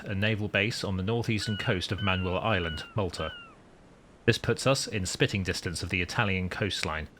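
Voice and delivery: deliberate, composed storytelling; captain's log narration. Acted. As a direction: narrate it like a submarine commander's log.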